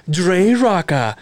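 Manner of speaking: slow, drawn-out announcer name drop; radio intro voice. Retold or imitated. Friend imitating his buddy's radio show intro, slow announcer voice. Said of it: "he's got people doing his intros".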